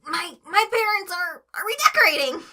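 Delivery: high, loud, stammering panicked voice; nervous Tweek lie. A narrator's reading. Tweek blurting a bad lie to Craig, high and panicky. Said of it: "Tweek badly replied".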